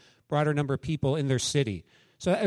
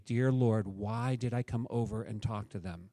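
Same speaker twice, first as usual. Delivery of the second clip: softer, lower regretful thought to God; inner prayer; 6 semitones lower, 8 dB quieter than usual. Retold or imitated. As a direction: mutter it as a private prayer. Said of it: "I was literally thinking".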